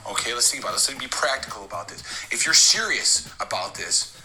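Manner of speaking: ordinary talk, nothing marked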